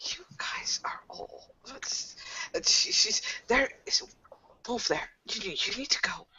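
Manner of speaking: frantic hushed whisper; whispered panic; urgent whisper. Acted. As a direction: whisper the warning so the wolf won't hear. Panicked warning about the wolf.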